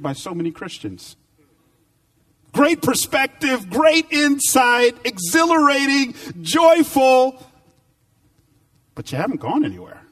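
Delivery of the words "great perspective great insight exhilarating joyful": much higher, louder, slow preached list; soaring preaching. Acted. Preacher listing the roller-coaster thrills to the church, soaring high preaching. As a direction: ride each word up in pitch like the thrill.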